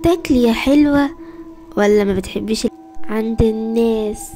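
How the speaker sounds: slow, sinister coaxing villain voice; creepy villain. Acted. Evil man luring the lost girl, slow sinister coaxing. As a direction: lure her in with false sweetness.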